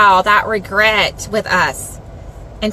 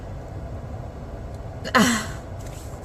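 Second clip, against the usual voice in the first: long, soft unvoiced sigh; 10 dB quieter than usual. Real, not acted. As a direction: let out a long quiet breath. Moved, near tears, pauses.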